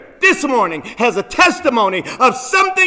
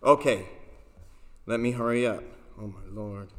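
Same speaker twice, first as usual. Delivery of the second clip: normal talking voice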